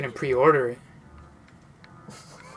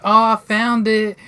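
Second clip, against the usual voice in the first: slow, loud, raised mock triumph; drawn-out, higher, louder exclamation; triumphant announcement; 5 semitones higher, 7 dB louder, slower than usual. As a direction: imitate a friend proudly calling out a find. Friend's triumphant find, acted.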